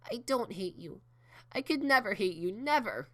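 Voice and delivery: 高く大きな涙声で叫ぶ; 泣きじゃくりながら必死に訴える; bawling. Acted. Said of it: "bawling without shame".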